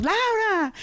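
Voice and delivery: high called-out name, stretched; calling out. Retold or imitated. Someone calling to distant Laura, high mock shout. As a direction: call a friend from far away, light mock shout. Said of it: "y están gritando".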